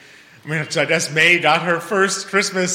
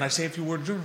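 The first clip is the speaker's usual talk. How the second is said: normal talking voice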